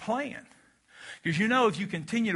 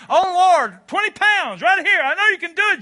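hammed-up pleading prayer, much higher and louder; a mock begging prayer to the Lord, much higher and louder; 9 semitones higher, 10 dB louder than usual. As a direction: pray for weight loss in a big hammed-up cry. Mock prayer to lose weight.

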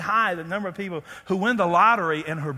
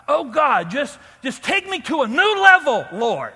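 rising, louder mock plea to God; imitating a churchgoer begging God for more; mock pleading prayer; 4 semitones higher, 5 dB louder than usual. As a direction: ham up a desperate prayer. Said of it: "made this prayer".

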